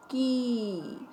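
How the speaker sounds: "की" drawn out on a falling glide; long sustained vowel in "की". Shown as a demonstration. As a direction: hold the ee of "की" for the class. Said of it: "आवाज़ को खींचकर बोला जाता है".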